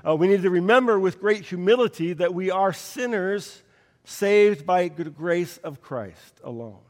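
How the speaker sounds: ordinary delivery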